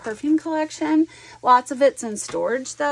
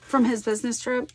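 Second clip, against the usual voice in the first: normal talking voice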